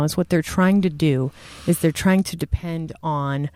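usual speaking voice

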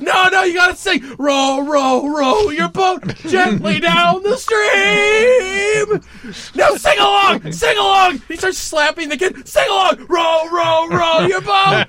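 high, loud manic sing-song; cat call sing-song. Retold or imitated. Killer forcing the bus kids to sing, manic high sing-song. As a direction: bark the nursery rhyme like an unhinged killer. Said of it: "that cat call sing-song voice".

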